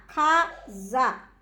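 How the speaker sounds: CASA split into two separate beats, ca, za; syllable by syllable. Shown as a demonstration. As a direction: say "casa" one slow syllable at a time. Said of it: "qual é a sílaba mais forte quando você pronuncia".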